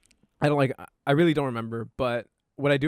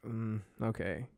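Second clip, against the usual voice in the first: slow, soft, half-hearted okay; reluctant agreement; 2 semitones lower, 11 dB quieter, slower than usual. Retold or imitated. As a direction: give in quietly, without enthusiasm. Retold reluctant agreement to play.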